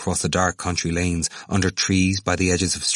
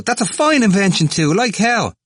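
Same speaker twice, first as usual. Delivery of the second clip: high, loud, scornful retort; crying out; 14 semitones higher, 6 dB louder than usual. A narrator's reading. Temple mocking the idea of limbo, scornful cry. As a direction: throw it back loudly, pitch way up. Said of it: "cried Temple".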